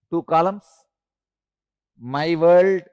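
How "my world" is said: usual speaking voice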